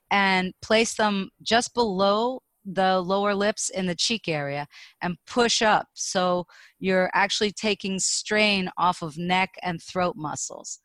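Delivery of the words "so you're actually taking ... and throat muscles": ordinary talk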